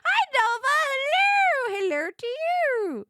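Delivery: very high, loud squeal; squealing greeting. Real, not acted. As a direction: squeal hello at the screen. Greeting a character on screen.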